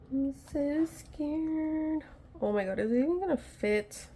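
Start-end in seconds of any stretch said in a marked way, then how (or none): 0.1-2.0 higher and very slow, stretching "so" in fright; drawn-out scared wail. Real, not acted. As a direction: moan it out, genuinely frightened.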